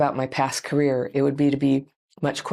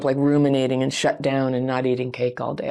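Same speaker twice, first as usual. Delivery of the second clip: ordinary talk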